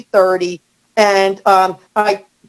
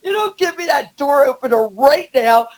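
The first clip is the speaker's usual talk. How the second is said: mock angry threat; 5 semitones higher than usual. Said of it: "I said a lot worse language".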